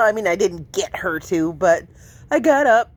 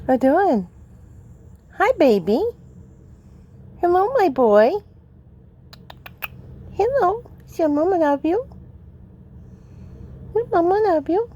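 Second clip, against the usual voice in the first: high, cooing pet talk; 7 semitones higher than usual. Real, not acted. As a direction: coo at the cat. Baby talk to cat.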